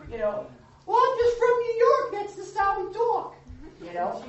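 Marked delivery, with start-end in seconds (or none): 0.9-3.3 high mock voice of a New Yorker excusing himself.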